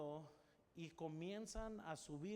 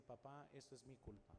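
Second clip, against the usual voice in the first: mild imitated crying; 5 semitones lower, 9 dB quieter than usual. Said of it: "comenzó a llorar".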